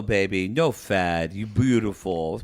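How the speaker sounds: put-on low, slow reassuring boyfriend; imitating Hamza. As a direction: drop the pitch and soothe in broken English. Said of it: "And he's like".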